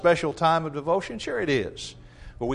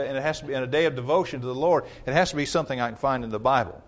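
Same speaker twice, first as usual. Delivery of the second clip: normal talking voice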